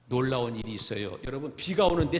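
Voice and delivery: ordinary talk, nothing marked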